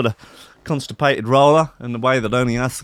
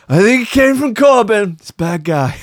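higher, louder Trump blame; mock Trump; 9 semitones higher, 5 dB louder than usual. Mocking Trump blaming Corbyn, higher louder voice. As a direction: point the finger like Trump.

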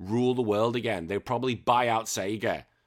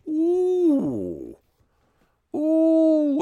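intrigued ooh; 6 dB louder than usual. Real, not acted. Reacting to tough question.

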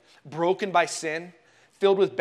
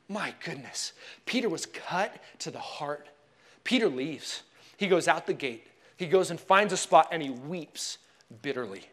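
normal talking voice

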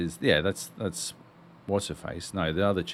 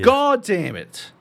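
exasperated; 10 semitones higher, 6 dB louder, slower than usual. Real, not acted. Exasperated at the film.

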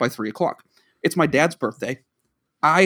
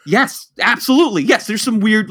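high, loud, vindicated yes; emphatic agreement; 5 semitones higher, 4 dB louder than usual. Retold or imitated. Husband confirming wife's suspicion of Brian, emphatic agreement. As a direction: burst out agreeing, hammed up.

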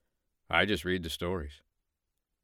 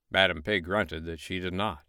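plain reading voice

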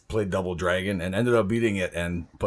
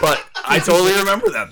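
laughing; 10 semitones higher, 8 dB louder than usual. Real, not acted. Laughing excitedly.

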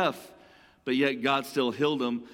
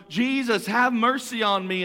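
yelling out; 5 semitones higher, 3 dB louder than usual. Retold or imitated. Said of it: "he yelled all the more".

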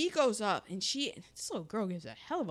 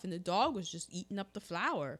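ordinary delivery

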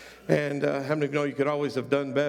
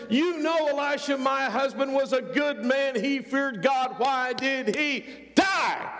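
grieving widow's cry; 8 semitones higher than usual. Acted. Widow asking Elisha why her good husband died, crying out in higher-pitched anguish. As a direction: wail it like a widow demanding an answer.

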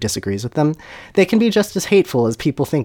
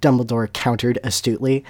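ordinary delivery

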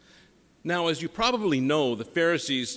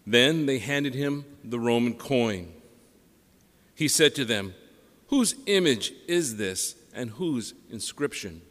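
solemn, measured liturgical reading; Gospel reading; 3 semitones lower than usual. Acted.

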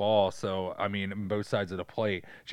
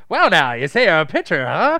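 leering scout; 4 semitones higher, 11 dB louder than usual. Retold or imitated. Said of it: "And he's like".

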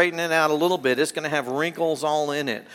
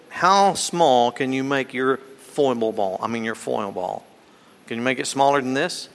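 normal talking voice

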